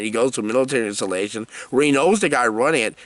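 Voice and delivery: usual speaking voice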